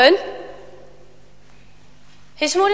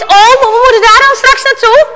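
higher, louder frightened thought; raised fearful child's thought; alarmed boy; 6 semitones higher, 7 dB louder than usual. Acted. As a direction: blurt out the dread. Boy dreads going to the dragon.

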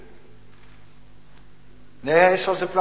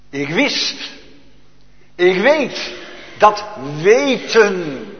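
ringing proclamation; 7 dB louder, slower than usual. Acted. Preacher proclaims certainty.

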